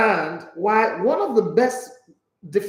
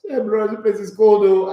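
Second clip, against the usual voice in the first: put-on Yoruba boy voice; 3 semitones higher, 7 dB louder than usual. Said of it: "the real Yoruba boy name showed up".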